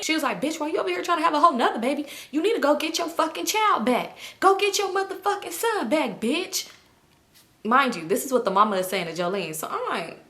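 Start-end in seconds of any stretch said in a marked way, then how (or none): 0.4-6.7 high-pitched imitation of an angry telling-off; mock-angry, high tirade; scolding mother. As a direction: scold in a raised pitch, acting out a quarrel. Acting out mother scolding Jolene.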